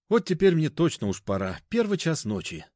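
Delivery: a hint of a fussing woman rushing off; hurried older woman. A narrator's reading. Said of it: "заторопилась Дарья Ивановна".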